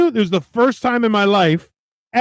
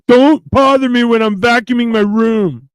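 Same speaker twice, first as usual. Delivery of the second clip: loud, raised-pitch mock outburst; Dewey; 5 semitones higher, 5 dB louder than usual. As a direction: imitate a movie character indignantly demanding to be left alone. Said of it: "Dewey in Scary Movie".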